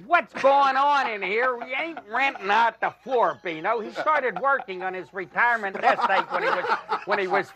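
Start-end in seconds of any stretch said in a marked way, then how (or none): 0.1-3.8 bellowing at Bino in mock fury; retold furious yell. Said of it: "And I was so furious".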